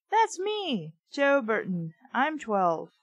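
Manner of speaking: ordinary talk, nothing marked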